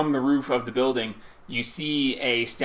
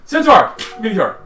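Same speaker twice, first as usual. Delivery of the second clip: mock gasp; 7 semitones higher, 8 dB louder than usual. GM mocks player's surprise.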